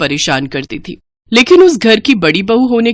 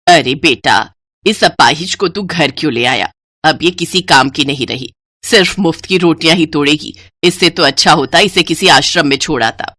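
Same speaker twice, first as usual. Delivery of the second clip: lower, cold contempt; lower, scornful old woman; heartless mother-in-law; 3 semitones lower than usual. Acted. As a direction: dismiss the crippled bride to her son. Mother-in-law scorns crippled bride.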